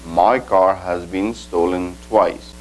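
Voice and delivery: slow, careful English translation; teacher giving a slow English example; slow model sentence. Shown as a demonstration. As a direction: read it slowly for learners. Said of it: "le doy el siguiente ejemplo".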